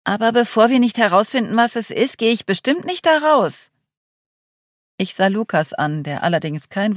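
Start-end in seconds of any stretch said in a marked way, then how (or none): 0.0-3.7 high, louder, fast agitated outburst; blurted out. Acted. Charlie refusing to go outside, agitated outburst. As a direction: burst out in a rush of alarm. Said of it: "stieß Charlie aus".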